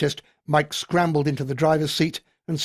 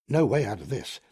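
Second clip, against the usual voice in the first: plain reading voice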